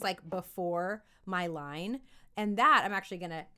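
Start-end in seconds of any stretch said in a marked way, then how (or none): none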